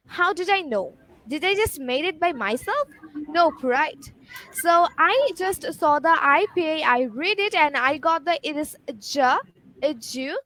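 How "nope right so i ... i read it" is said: ordinary delivery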